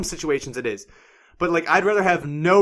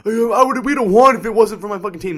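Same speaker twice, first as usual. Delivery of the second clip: higher, louder griping imitation; mimicked excuse-making, pitched up; mock complaining player; 6 semitones higher, 5 dB louder than usual. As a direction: blame your teammates like a sore loser. Mimics player blaming the team.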